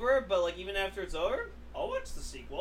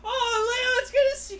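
mock sweet brother; 5 dB louder than usual. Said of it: "His brother just comes in".

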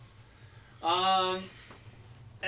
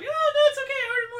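very high, loud, giggling offer; laughing fake politeness; 8 dB louder, much faster than usual. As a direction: squeal through a laugh while offering food. Acted polite reply, laughing.

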